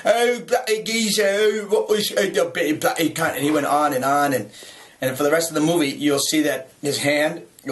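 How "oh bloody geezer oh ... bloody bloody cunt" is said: imitating a Cockney actor swearing in pain, higher; mock Cockney outburst after smashed fingers. Mimicking the hurt co-star's Cockney cursing, higher. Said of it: "he went into some Cockney Tourette's thing".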